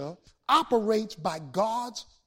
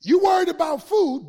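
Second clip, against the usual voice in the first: shouted high and loud from the pulpit; preaching shout; 7 semitones higher, 10 dB louder than usual. Real, not acted. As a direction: belt it out at the congregation.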